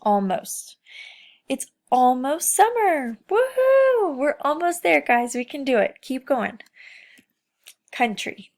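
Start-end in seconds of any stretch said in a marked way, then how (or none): 1.5-6.6 bright, higher and louder cheering; rally the kids with real excitement; excited cheer. Acted. Teacher cheers her class on toward summer, excited.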